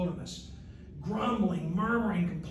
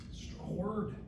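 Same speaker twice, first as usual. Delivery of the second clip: whispered aside; 3 semitones lower, 12 dB quieter than usual. Real, not acted. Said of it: "whispered aside".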